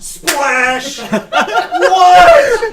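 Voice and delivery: loud, high startled yell with a laugh; laughing shocked outcry; shocked shout. Acted. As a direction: shriek it in disbelief. GM acting the surprise.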